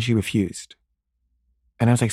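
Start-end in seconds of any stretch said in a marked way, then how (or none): none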